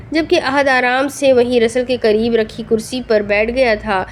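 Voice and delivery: usual speaking voice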